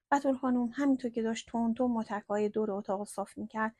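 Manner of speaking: ordinary delivery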